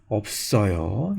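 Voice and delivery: low, soft and drawn out; resigned low answer. Acted. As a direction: break the bad news slowly and softly. Teacher answers the imagined student. (낮고 조용하게 길게 끌며)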